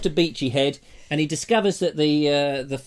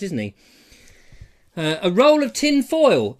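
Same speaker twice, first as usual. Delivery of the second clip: ordinary delivery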